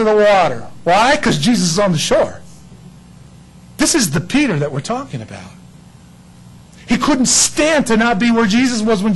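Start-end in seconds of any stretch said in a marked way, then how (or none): none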